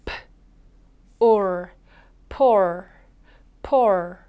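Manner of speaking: "poor" built up sound by sound: p, then or, then the whole word twice; 소리를 나눠 보여주는 poor. Shown as a demonstration. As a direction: break "poor" into p and or, then blend it. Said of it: "함께 연습해 주세요".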